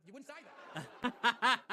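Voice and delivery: very slow, loud, laughing punchline. Real, not acted. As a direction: drag it out while laughing.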